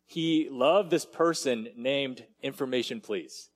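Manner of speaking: normal talking voice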